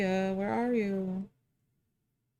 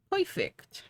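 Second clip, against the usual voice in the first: squeaky high voice; 12 semitones higher, 4 dB quieter than usual. Pretend. Squeaky repeat.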